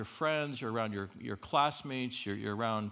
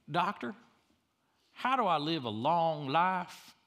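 funny patient voice; 5 semitones higher, 3 dB louder than usual. Retold or imitated. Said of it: "he was funny. He goes".

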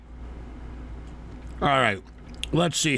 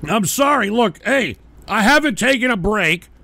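ordinary delivery

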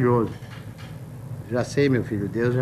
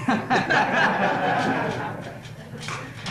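high unvoiced wheeze of laughter; breathless laugh; 7 semitones higher, 4 dB quieter than usual. Real, not acted. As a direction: laugh silently at the punchline. Laughs at punchline.

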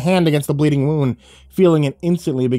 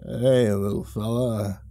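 put-on drunk hiccup; 3 semitones lower, 6 dB quieter, slower than usual. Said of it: "the man hiccuped".

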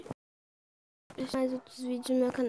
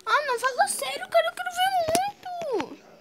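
excited high voice; 14 semitones higher than usual. Real, not acted. Excited squeal.

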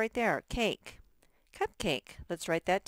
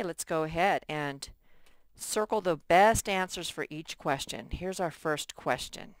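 ordinary talk, nothing marked

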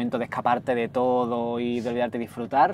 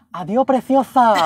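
slow, high, loud call of a woman in the crowd; imitated shout; 9 semitones higher, 7 dB louder, slower than usual. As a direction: call it out like a fan on the street. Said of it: "una señora gritando muy fuerte".

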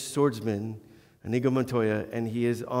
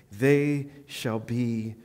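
slow promise; 3 semitones lower, much slower than usual. Acted. Reading God's promise, verse seventeen.

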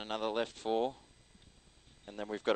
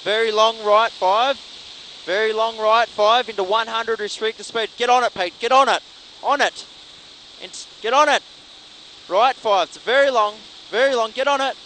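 loud, urgent co-driver calls; rapid rally pace notes; 11 semitones higher, 14 dB louder than usual. Real, not acted. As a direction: call the corners fast and loud.

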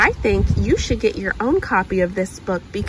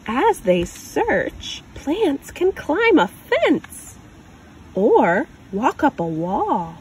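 put-on reading to a child. Parent reading a picture book aloud, gentle storytelling. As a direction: read it like a picture book for a little one.

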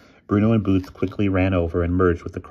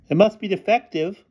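put-on high girlish voice; calling out; 14 semitones higher, 4 dB louder than usual. A narrator's reading. Girl calls an excuse to the headmistress, high voice. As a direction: call it out in a much higher voice. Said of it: "called out Kathy finally".

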